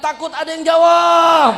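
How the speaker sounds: high, loud frightened blurt; frightened shout. Acted. Scared student shouting his excuse to the teacher. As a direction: yell it out in fright.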